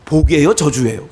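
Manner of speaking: ordinary delivery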